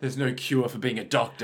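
low and grave, in jest; mock-dramatic doctor-hater. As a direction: echo it with hammy gravity. Echoes the character's brooding line.

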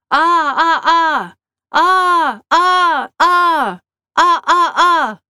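high, loud "ah" yells in threes; short yells, then longer, then short; repeated yells. A narrator's reading. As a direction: yell for help in rhythmic bursts. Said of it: "Let's yell, too".

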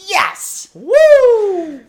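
high loud cheer. Real, not acted. Cohost cheering the story's payoff, high loud whoop. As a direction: whoop with delight.

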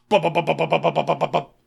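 rapid mouth-made gunfire bursts; spray the room with a voiced machine gun; gun sound effect. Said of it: "wrong sound effect".

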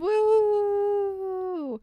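a whooping celebration; high excited woo. Real, not acted. Plugging the merch store to listeners, a high excited cheer.

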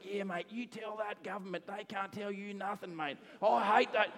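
high-pitched, hammed-up Aussie mates egging on. Fish's mates ranting about government, hammed-up Aussie. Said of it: "all of his Aussie mates are like".

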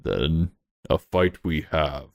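very low, slow creature voice; creature from beneath. Acted. Creature beneath accepts the fight, deep and slow. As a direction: answer deep and slow, as a challenge. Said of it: "from beneath once again".

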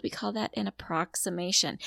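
usual speaking voice

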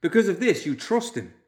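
high-pitched, loud disbelief; indignant outburst. A narrator's reading. Young man challenges the leaders' trust, bursting out. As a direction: fling it out, incredulous and pitched high. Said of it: "Loomis burst out".

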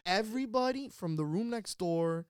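normal talking voice

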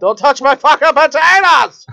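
high, loud, slow mock yell. Father yelling at his kids, mock loud yell. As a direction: bark it at the kids over potatoes. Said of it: "That's what I say to my kids".

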